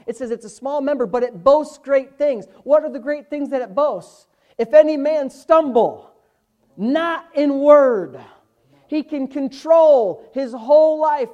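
loud, slow scripture proclamation; full-force preaching. Real, not acted. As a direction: hammer each phrase and stretch the key words.

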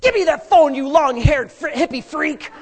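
loud mock-furious father; angry dad. Dad yelling at the hippie brother, angrily. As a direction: shout it like a dad grabbing the phone.

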